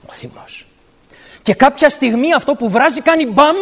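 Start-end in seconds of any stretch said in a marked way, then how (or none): 0.1-0.6 whisper. Acted. Whispers what boils inside.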